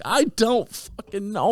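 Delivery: slow, high-pitched mock despair; helpless exasperation. Answering his laughing friends, slow high exasperation. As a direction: drag out each word up high.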